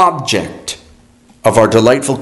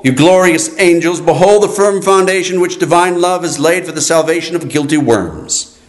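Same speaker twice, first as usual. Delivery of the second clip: normal talking voice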